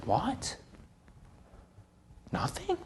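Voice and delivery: soft, high, incredulous question; a boy who can't believe it; hushed shocked what, nothing; stunned disbelief. Retold or imitated.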